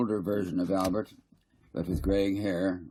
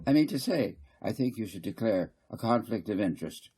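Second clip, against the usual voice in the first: fussy councillor Garth; 3 semitones higher than usual. Acted. Garth lecturing the council, higher and insistent. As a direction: press the point, repeating I mean to say.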